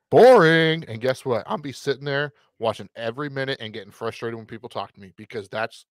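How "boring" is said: loud, raised, drawn-out verdict; mock-announcer punchline. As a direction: belt it out as the big reveal. Punchline of mock game billing.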